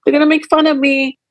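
put-on high, whiny little-kid voice; a child afraid of being mocked; scared little child. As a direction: voice the hiding child, small and fearful. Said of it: "the little child hiding, saying".

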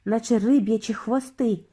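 usual speaking voice

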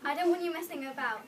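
loud, firm warning; stern telling-off. A narrator's reading. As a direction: scold lightly but loudly. Santa tells reindeer off.

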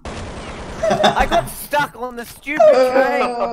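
high, loud laughing yell; laughing shout. Real, not acted. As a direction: yell it while cracking up. Stuck on train, laughing.

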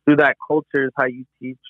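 ordinary talk, nothing marked

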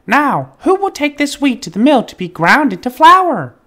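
very high, loud hen voice; hen asking. A narrator's reading. The hen asking the farm animals for help, high and loud. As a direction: call out brightly for helpers. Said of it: "the little red hen asked".

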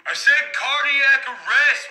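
normal talking voice

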